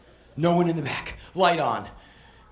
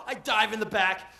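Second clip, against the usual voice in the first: ordinary talk, nothing marked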